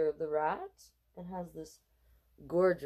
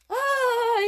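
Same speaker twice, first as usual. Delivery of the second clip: loud, high scream; mom screams; 9 dB louder than usual. Acted. Mom screaming around the kitchen table. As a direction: shriek in fright. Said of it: "yelled Donovan's mom".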